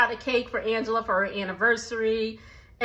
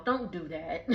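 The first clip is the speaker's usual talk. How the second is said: softer, laughing through the words; laughing softly; 6 semitones lower, 8 dB quieter than usual. Real, not acted. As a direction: let the laugh break in quietly. Laughing at the show.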